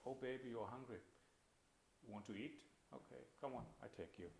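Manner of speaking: put-on very soft, lifeless mother's words to the baby; depressed mother. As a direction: go through the motions with no feeling. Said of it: "imagine the mother is, uh, severely depressed".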